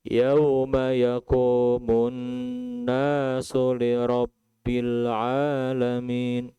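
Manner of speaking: slow, chanted verse in a held tone; slow Quran recitation. Acted. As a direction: intone the verse slowly and reverently.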